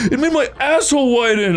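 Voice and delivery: high-pitched whiny wail; hammed-up distressed whine; mock crying. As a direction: imitate a friend tearfully complaining. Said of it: "he was, like, crying about it".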